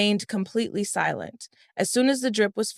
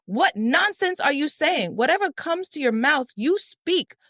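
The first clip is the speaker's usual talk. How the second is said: outraged shout defending his brother; angry scream; 7 semitones higher than usual. A narrator's reading. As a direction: hurl it back at her in rage, pitched way up. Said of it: "Farouk screamed angrily".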